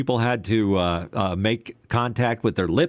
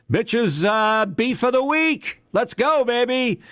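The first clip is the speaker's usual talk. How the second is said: segment announcer; 14 semitones higher, slower than usual. Acted. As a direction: hype up the segment like a radio DJ.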